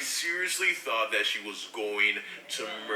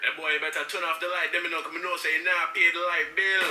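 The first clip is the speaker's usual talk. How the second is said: imitating a Jamaican mom, louder at the end; Jamaican parent accent; 6 semitones higher, 3 dB louder than usual. Parent scolding the boy about the lights, Jamaican accent. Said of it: "that was a strong accent".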